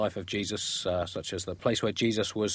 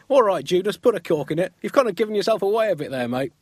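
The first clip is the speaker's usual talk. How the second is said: higher, louder mock Jesus scolding Judas; voicing Jesus; 8 semitones higher, 5 dB louder than usual. Jesus tells Judas to shut up, higher mock voice. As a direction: chide him like a fed-up mate.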